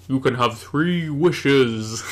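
slow grand genie announcement. Pretend. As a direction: slowly proclaim the wishes as genie. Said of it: "This definitely sounds like Luke".

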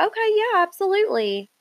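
high-pitched, keen yes; imitated polite enthusiasm; acted eager agreement. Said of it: "I'd be like".

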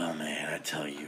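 long sigh into a whispered aside; relieved sigh. Real, not acted. Host exhales after his rant, relieved sigh. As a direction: let it all out with a sigh. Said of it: "That feel good?".

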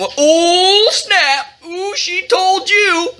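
high, drawn-out "ooh" of a mock crowd; taunting jeer. A narrator's reading. Onlookers reacting to a put-down, taunting.

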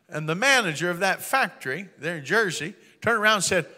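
normal talking voice

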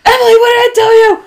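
high, loud, fast scolding yell. Retold or imitated. Retelling telling off noisy Emily, yelling. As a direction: holler it at the culprit. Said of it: "I'll yell at her".

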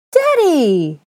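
put-on high, loud child's squeal; shrieked, delighted greeting; scream of delight. A narrator's reading. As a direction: cry out in childish joy. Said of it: "A scream of delight".

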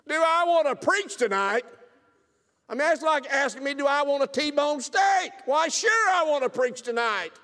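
loud, high, excited shout; eager shout. Real, not acted. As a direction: bellow it like he can't wait. Eager yes to preaching.

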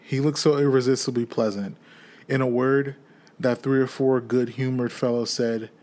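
ordinary delivery

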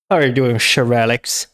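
usual speaking voice

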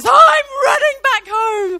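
high, loud hammed-up holler; exuberant, comic top-of-the-voice cry; excited shout. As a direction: yell it out as you dash off. Simba's cry as he dashes home.

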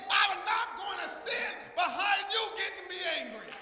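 high, loud punch on each repeated refrain, dropping on the answer; impassioned preaching. Real, not acted. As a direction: build it defiantly like a pulpit chant. Preaching refrain at full force.

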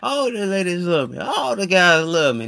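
high, loud cocky boast; mock boast of a ladies' man; mock bragging dude. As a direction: show off like a player.

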